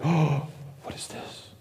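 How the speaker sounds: a breathless, awed whisper of a stunned crowd; hushed, slow, high and amazed; astonished whisper. Retold or imitated. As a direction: gasp it out slowly under your breath. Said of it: "so astonished".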